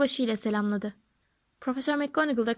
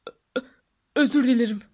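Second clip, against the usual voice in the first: stammered sobs bursting into a louder apology; sobbing apology; 4 dB louder than usual. A narrator's reading. Giant apologizing to the professor, acted sobbing. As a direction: choke on the first syllable, then blurt it out. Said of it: "diye hıçkırdı".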